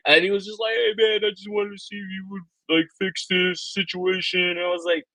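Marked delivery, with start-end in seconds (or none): 0.8-4.4 high put-on customer voice, slowing at the end; imitated complaining customer. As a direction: mimic the buyer in a high, mocking voice. Imitates complaining customer in retold argument.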